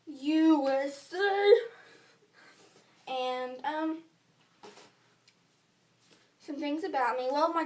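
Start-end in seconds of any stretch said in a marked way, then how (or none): 0.1-3.1 loud, long drawn-out letters, pitch climbing; showy cheer. Real, not acted. Girl telling viewers where she comes from, showy cheer. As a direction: stretch each letter out loudly and proudly. Showy announcement of her country.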